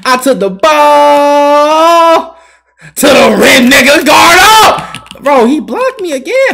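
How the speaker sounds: high, slow, loud complaining shout; drawn-out loud outrage; frustrated yell. Real, not acted. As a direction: howl it at the game. Gamer yelling at blocked shot.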